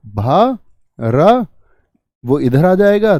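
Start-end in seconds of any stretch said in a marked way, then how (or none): 0.1-1.5 BHA and RA drawn out separately; syllable by syllable. Shown as a demonstration. Teacher breaking a word down for students, syllable by syllable. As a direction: sound out भा, रा as long, separate syllables. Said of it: "देखो".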